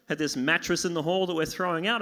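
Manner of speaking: usual speaking voice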